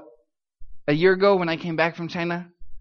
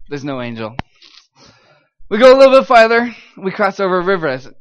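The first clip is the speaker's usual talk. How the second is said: usual speaking voice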